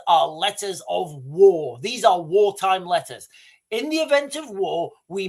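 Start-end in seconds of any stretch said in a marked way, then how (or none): none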